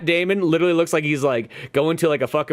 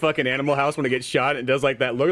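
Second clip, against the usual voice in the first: ordinary delivery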